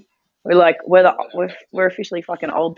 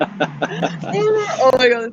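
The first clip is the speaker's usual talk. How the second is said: delighted gasp; 7 semitones higher, much slower than usual. Retold or imitated. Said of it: "and she's like".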